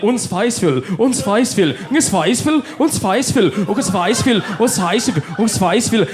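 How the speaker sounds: repeated faux-German chant; mock German. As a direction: imitate a Danish TV ad in gibberish German. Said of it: "It's like".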